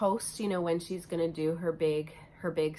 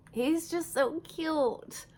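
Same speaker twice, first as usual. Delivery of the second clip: cooing; 10 semitones higher, much slower than usual. Real, not acted. Cooing over a fairy door.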